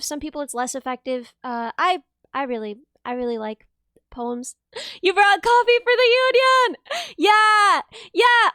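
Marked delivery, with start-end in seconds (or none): 5.0-8.5 high, loud, delighted shout; whooping with excitement; excited cheer. Real, not acted. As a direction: cheer them on loudly, pitched way up. Excited calling out to chat.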